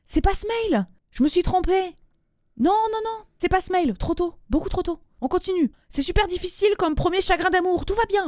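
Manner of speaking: high-pitched, embarrassed backtracking; flustered. Real, not acted. Host admitting she mixed up mails, flustered high voice. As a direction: scramble to correct yourself, high-voiced.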